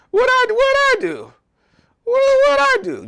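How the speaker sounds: squeaky, high and loud disbelief; mock outrage. Shown as a demonstration. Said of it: "just, just like that".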